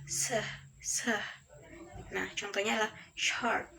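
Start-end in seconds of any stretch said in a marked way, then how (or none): 0.1-1.1 se said as a hushing "shuh"; teacher modelling long-s sound; sh sound demo. Shown as a demonstration. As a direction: sound out se as "sh", twice. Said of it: "S panjang itu cara bacanya".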